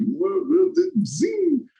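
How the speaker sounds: high, squealed whoa and zing noises; comic sound effects. Retold or imitated. Getting thrown around by Charles, high cartoon noises.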